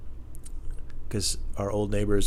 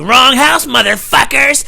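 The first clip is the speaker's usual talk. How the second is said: high, loud taunting yell; hammed-up bravado; mock tough shout; 14 dB louder, slower than usual. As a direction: threaten the burglars mockingly. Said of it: "and I'm like".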